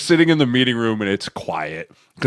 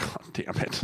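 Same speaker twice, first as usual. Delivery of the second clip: breathy whispered groan; whispered dread; 13 dB quieter than usual. Retold or imitated. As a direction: whisper it under your breath, caught out. Player reacting to angry coach.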